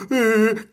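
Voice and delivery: exaggerated high wail; mock crying. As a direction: fake bawling noise.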